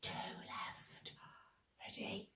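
hushed whisper. Shown as a demonstration. Leader whispering to the children. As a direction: whisper to the kids.

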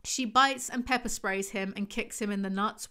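ordinary talk

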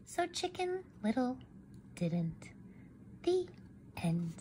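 soft whisper to end the tale. Acted. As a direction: hush it down to a whisper. Quiet close of interrupted story.

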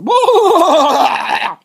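sudden high, loud laugh; burst of laughter. Real, not acted. Vlogger breaking into a high laugh mid-complaint. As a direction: crack up in the middle of the rant.

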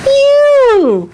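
excited. Acted. Peekaboo reveal to baby.

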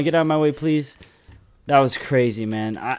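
usual speaking voice

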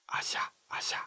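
voiceless whispered アジャ, very soft; アジャ in a hushed, careful whisper. Shown as a demonstration. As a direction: whisper アジャ as a sound demonstration. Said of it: "ジャで同じことをやると".

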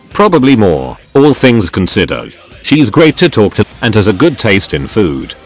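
plain reading voice

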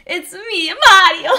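high, loud cartoon Italian accent; hammed-up Italian accent, punched out; Mario. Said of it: "The accent is Italian".

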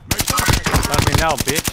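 gloating taunt after a kill; slow, loud gloat. Real, not acted. Trash talk after a kill.

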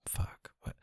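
barely audible whispered swear; muttered curse. Acted. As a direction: curse under your breath as if caught out. Caught out, swears under breath.

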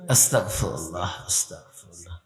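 very low, quiet murmur; hushed istighfar. Real, not acted. Preacher to himself after sound-system trouble, hushed murmur. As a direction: murmur it to yourself, fading almost to nothing.

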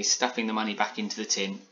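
plain reading voice

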